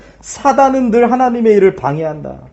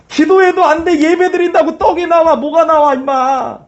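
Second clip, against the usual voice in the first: higher, jeering devil's voice; voice of Satan; 4 semitones higher than usual. Acted. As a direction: sneer at their faith, pitched up. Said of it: "이 사단의 소리예요". (사탄이 믿음을 비웃는 높은 목소리)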